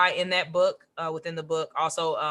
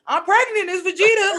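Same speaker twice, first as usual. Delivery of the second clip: hammed-up announcement, higher and louder; mock Bulma announcement; 12 semitones higher, 8 dB louder than usual. Retelling how Bulma suddenly announces her pregnancy, in a high, loud mock voice. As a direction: blurt out the news like a cartoon heroine. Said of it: "Bulma was like".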